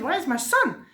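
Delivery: angry demand. Acted. Parvati confronting Shiv about her son, mildly angry. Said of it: "she was angry at Shiv and said".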